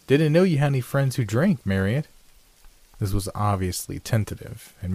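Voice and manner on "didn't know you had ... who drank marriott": higher, louder, forced casual remark; strained attempt at casual chat. A narrator's reading. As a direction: force some lightness into a probing question. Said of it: "with a rather forced tone".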